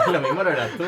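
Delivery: laughing through the teasing line; giggling tease. Real, not acted. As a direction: tease him while cracking up. Teasing guest while laughing.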